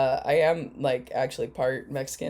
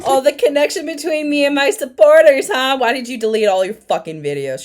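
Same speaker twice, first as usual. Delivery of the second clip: very loud, high, sarcastic echo; jeering, indignant near-shout; mocking outburst; 10 semitones higher, 10 dB louder than usual. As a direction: parrot it scornfully at full volume, then demand an answer. Mocks the clip, angrily challenging it.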